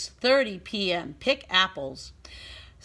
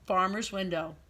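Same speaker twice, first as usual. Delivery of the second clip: usual speaking voice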